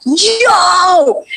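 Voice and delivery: high, drawn-out excited "yo"; uncontained excitement. Retold or imitated. Actor reliving her first look at the character's picture, excited. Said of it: "I couldn't hardly contain myself".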